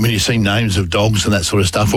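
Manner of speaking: usual speaking voice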